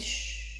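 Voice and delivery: soft, drawn hushing sound; shushing. A narrator's reading. Professor with a finger on his lips, soft shush. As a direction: hush the listener quietly. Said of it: "عاود حط صباعو على فمو وقال".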